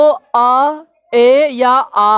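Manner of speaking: drawn out very slowly, sound by sound; slow spelled-out sounds. Shown as a demonstration. Teacher to pupils, slowly drawing out each vowel sound. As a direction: stretch each vowel for the class. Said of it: "पहला नियम कहता है".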